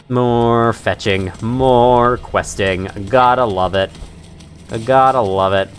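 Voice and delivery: slow, low, drawn-out drone; weary sarcasm. Real, not acted. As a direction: drag the words out with resigned irony.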